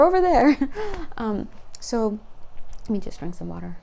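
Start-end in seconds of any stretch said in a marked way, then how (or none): 0.0-0.6 high, loud repeat, stress on over; playful call. Pretend. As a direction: call it again, leaning on "over".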